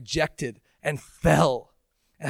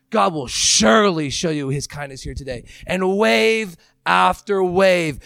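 preaching at full force; 2 semitones higher, 6 dB louder than usual. Acted. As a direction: build to a roaring climax. Climax of sermon.

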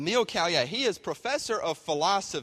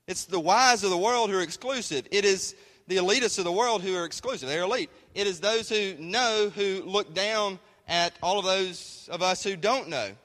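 louder, higher preaching with punched key words; rising preaching; 6 semitones higher, 5 dB louder than usual. Acted. Preacher calling out the world's elitists, with rising force. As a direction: drive the point home to the congregation.

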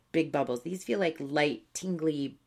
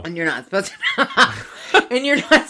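laughing; 6 semitones higher, 8 dB louder than usual. Real, not acted. Giggling while telling the story.

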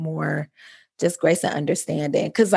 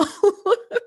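short bright laugh rising at the end; real high laugh; 13 semitones higher than usual. Real, not acted. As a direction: laugh off the cliché. Laughs after her point.

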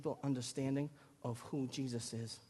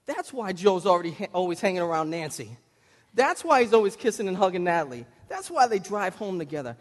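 excited realization; 4 semitones higher, 10 dB louder than usual. Retold or imitated. Brother piecing it together.

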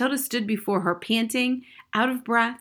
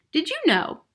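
blurted, higher-pitched question; sudden; 9 semitones higher than usual. A narrator's reading. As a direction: burst in with a sudden eager question. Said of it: "Matilda said suddenly".

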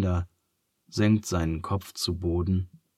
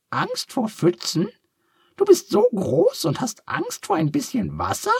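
very high, squeaky little duck, curious; a cheerful duck asking kindly; 3 dB louder than usual. A narrator's reading. Duck is amazed.

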